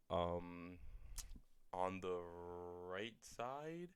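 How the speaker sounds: halting, soft answer rising into a question; stall, then guess with a questioning lift; hesitant guess. Retold or imitated.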